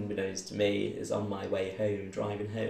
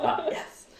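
triumphant. Retold or imitated. Feeling he won.

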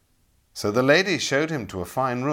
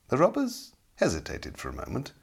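ordinary delivery, nothing marked

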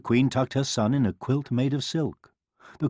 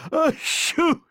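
high, acted storybook sneeze; queen's dainty-pitched loud sneeze; 4 dB louder than usual. A narrator's reading. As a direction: let out an explosive high sneeze. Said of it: "sneezed the queen".

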